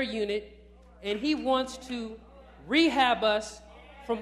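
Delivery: emphatic sermon delivery, projected and insistent; forceful preaching. Real, not acted. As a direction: press it on the congregation in weighty phrases.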